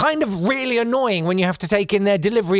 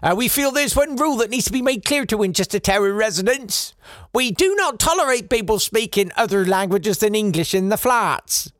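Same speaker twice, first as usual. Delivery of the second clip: put-on Norfolk poster-writer, somewhat raised; mock Norfolk accent; 4 semitones higher than usual. Poster writer addressing Winchester Tower residents, mock Norfolk accent. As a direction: declare the rules in a bad Norfolk accent. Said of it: "I know that's not how they talk".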